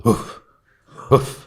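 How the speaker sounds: toneless, puffed dog woofs; imitated little barks. Shown as a demonstration. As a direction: imitate small, breathy barks. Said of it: "Could you imitate those?".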